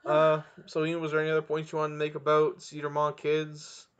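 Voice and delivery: normal talking voice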